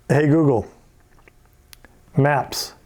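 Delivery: loud clear command to the assistant. Shown as a demonstration. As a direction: speak to the mic. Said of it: "I have to go".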